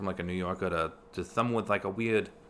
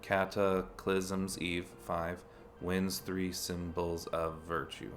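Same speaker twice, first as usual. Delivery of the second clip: counting syllables; 2 semitones lower, 4 dB quieter, much slower than usual. Shown as a demonstration. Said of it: "no, that's six".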